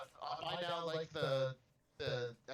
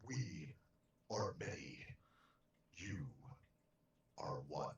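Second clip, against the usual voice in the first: lower, grave regal pronouncement; crown voice; 10 semitones lower, 5 dB quieter than usual. Acted. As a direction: intone it like a swarm queen. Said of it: "say it in your crown voice".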